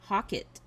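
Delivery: "Hockett" with an open "hah" first syllable; pronunciation attempt. Shown as a demonstration. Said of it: "Tell me how to say that".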